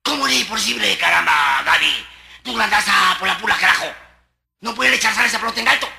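loud scolding of the player; scolding coach. Retold or imitated. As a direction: chew her out loud. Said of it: "La chica se venían".

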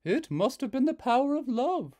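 put-on mother answering her girl; Hazel's mother. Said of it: "said Hazel's mother".

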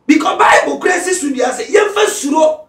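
loud, high preaching shout; preaching at full force. Acted. Pastor exhorts listeners about social media, full-force preaching. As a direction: pastor building to a fervent shout.

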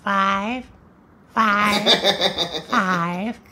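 "five" drawn out, each one separate; "five" held long with a pause after each; odd-sounding five. Acted. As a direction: repeat "five" slowly as isolated, stretched words. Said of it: "Why'd you say five like that?".

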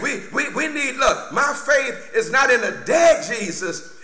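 ordinary talk

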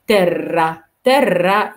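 "ترا" with a long rolled r; doubled r. Shown as a demonstration. Teacher modelling double r, long rolled r. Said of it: "وفي هنا ار ار".

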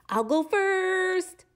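high, slow eager bird; pigeon voice. Acted. Pigeon claiming the first turn, high and eager. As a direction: jump in eagerly.

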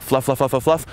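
high, rapid repeated babble; playful filler patter; mock fluff chatter. Shown as a demonstration. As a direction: rattle it off lightly and high. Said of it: "It's like".

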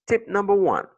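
high, loud announcement of the tip; loud tip heading. Real, not acted. Teacher loudly announces the next tip to students. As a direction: call out the heading to the class.

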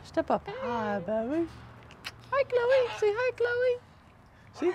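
high sing-song pet talk. Real, not acted. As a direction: coo at the bird sweetly. Cooing to pet bird.

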